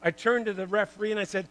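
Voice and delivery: normal talking voice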